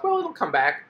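high-pitched mock optimism, brushing off the loss; hopeful denial. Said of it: "and you go".

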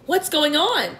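high, startled questioning; alarmed cry. A narrator's reading. James startled by the heaving floor, alarmed cry. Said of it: "cried James".

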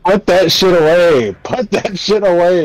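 high, loud yell; shouting. Real, not acted. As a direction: bark it at your friend. Yells at teammate in game.

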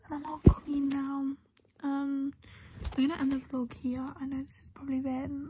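soft late-night whisper. Real, not acted.

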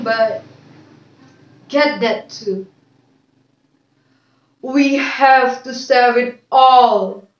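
slow, declaimed poem with long pauses; poem recitation. Acted. Student reciting a poem for a video, measured declamation. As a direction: recite it line by line, deliberately and clearly.

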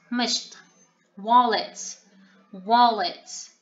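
usual speaking voice

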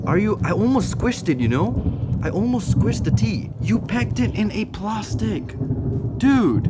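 high loud shocked scolding of the seller; real upset outburst. Real, not acted. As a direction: cry out in disbelief at the damaged parcel. Upset unboxing a squished tarantula.

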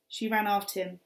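ordinary delivery

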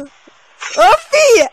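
high, loud startled cry; sudden yell. Real, not acted. Gamer shrieking at her friend when attacked, loud and high. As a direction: yell it out in alarm. Yelling in gameplay panic.

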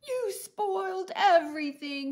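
high, loud furious shout. Acted. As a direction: scream it at the princess. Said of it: "he was shouting at her".

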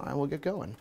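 normal talking voice

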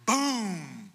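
mock gunshot, punched out loud; a quick loud boom like a shotgun; imitated shotgun boom. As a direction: bark out a shotgun blast. Gunshot in the woods.

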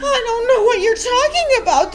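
high, loud, bewildered denial; puzzled protest. A narrator's reading. Accused boy answers the headmistress, high and puzzled. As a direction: protest it in total bafflement. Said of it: "more puzzled than ever".

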